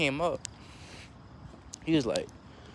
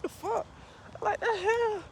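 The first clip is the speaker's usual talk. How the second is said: very high, squeaky mock bafflement; baffled disbelief; 4 dB quieter, slower than usual. Runner baffled that smoker cousin keeps up, high incredulous squeal. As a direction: shoot the voice way up in disbelief.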